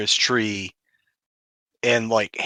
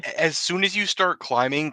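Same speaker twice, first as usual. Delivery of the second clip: normal talking voice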